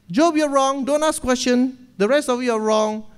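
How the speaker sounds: put-on young Elihu scolding Job and friends, loud and high; angry scolding. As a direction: scold them all, hammed-up. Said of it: "Elihu was so angry".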